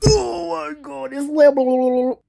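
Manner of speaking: high, slow fake sob; hammed-up weeping, high and slow; mock crying. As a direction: whimper it out in mock despair. Crying over the level.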